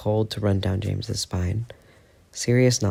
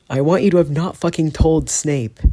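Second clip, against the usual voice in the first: loud, higher, pained snap; snapping; 7 semitones higher, 6 dB louder than usual. Acted. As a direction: rip the words out like they hurt. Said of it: "he snaps".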